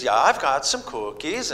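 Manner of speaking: slow, light Norwegian lilt of grandma; a hint of Norwegian grandma, drawn out; Norwegian accent. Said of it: "as they talk like this".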